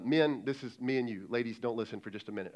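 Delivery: ordinary talk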